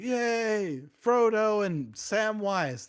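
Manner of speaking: high, stretched slow cheer; a hammed-up hobbit crowd cheering; mock crowd cheer. Shire crowd hails the returning heroes with a high, slow cheer. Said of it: "everyone's like".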